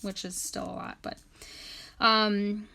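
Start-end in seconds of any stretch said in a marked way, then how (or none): none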